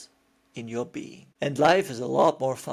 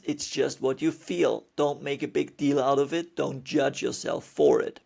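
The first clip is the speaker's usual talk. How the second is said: ordinary delivery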